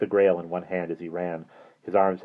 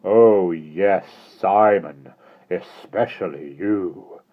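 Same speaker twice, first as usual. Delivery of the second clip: slow, louder, mildly insidious menace; 7 dB louder, much slower than usual. Acted. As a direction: coax them with a creeping threat, drawn out. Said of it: "gentle, insidious".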